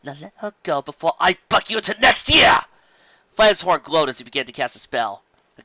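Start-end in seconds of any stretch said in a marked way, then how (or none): 0.0-2.6 higher, louder furious threat; angry shout. Acted. Carrot Top threatens Flam, shouting.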